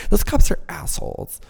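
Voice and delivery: mocking the innocent-camp viewer in a valley girl voice. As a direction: push "Those cops are assholes" up into full valley girl. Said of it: "talking like a valley girl".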